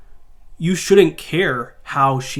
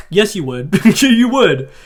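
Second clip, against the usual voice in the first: laughing; 5 semitones higher, 8 dB louder, slower than usual. Real, not acted. Retorting with a laugh.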